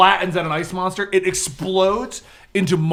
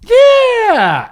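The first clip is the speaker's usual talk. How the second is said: excited yes; 7 dB louder than usual. Real, not acted. GM laughing yes.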